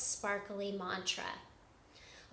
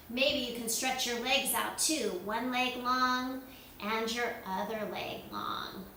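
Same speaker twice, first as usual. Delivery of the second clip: ordinary talk, nothing marked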